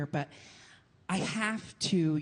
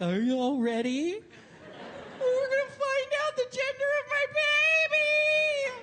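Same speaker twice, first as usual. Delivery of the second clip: hyped party host. Acted. Hyping the guests before the cake cut, high excitement. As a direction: whip the guests into a frenzy. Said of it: "and I'll be like".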